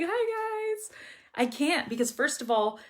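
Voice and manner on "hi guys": high, small slouched hello; slouched greeting. Shown as a demonstration. Teacher mocking a slouched vlogger greeting, high and small. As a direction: greet like someone sunk in a chair, pitched way up. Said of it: "sit like this".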